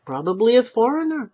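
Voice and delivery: a complacent shrug of a remark. Acted. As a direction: toss it off smugly. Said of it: "said complacently".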